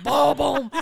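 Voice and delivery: punchy car-thump sound effect; imitated heavy bump. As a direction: boom out the speed-bump hit. It was like.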